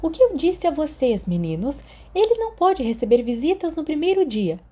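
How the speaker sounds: voz mais aguda de enfermeira repreendendo; repreensão da enfermeira. Acted. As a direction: bronca de enfermeira nos meninos. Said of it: "A repreensão de Madame Pomfrey". (enfermeira repreende os meninos, voz aguda)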